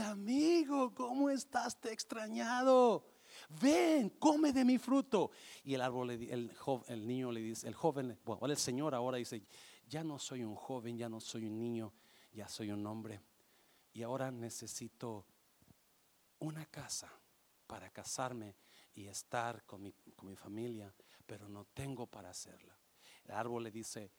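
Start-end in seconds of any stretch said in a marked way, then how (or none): 0.0-5.3 higher, bright-eyed greeting from the tree; happy tree voice. Acted. El árbol saluda de nuevo. 9.9-22.8 lower, softer man explaining his need; a hint of a weary adult; subdued grown man. El hombre pide una casa.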